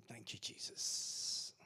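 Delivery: slow, soft whispered thanks; whispered prayer. Real, not acted. Pastor thanking Jesus, whispered prayer. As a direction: breathe a quiet thank-you.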